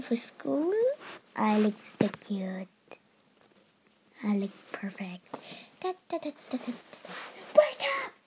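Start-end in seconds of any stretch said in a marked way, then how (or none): 1.4-5.4 whisper. Real, not acted. Whispered aside. 7.5-8.1 high loud call. Acted. Sister wakes her up.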